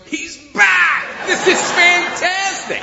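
high, loud, overjoyed shout; excited dog voice. Acted. Dog greeting her owner at the door in a high, thrilled shout. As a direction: bark it out like a thrilled dog coming unglued. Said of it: "Lulu looks up and goes".

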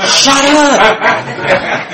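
high-pitched, drawn-out mock yell; acted snapping. Caller covering the phone to silence drunk roommate, mock snapping.

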